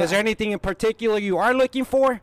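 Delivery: chirpy cold-call salesman; cheerful phone-sales voice to a pretend customer; telemarketer voice. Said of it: "just pretend I'm calling you".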